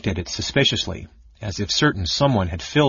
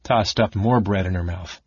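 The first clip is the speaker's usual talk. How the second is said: ordinary delivery, nothing marked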